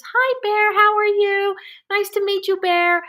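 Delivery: very high, loud sing-out hello; hammed-up sweet greeting to a bear; mock friendly greeting. Mocking meme's friendly bear greeting.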